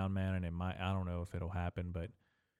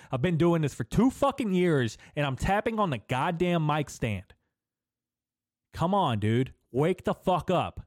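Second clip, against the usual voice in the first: higher and louder, scolding himself; angry self-rant; 6 semitones higher, 8 dB louder than usual. Real, not acted. Podcaster shouting at himself over tapping the mic, furious. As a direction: yell at yourself for an amateur mistake.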